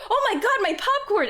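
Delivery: sudden panic, jumping out of bed; alarmed cry. Retold or imitated. Retelling her panicked shout as the popcorn burns.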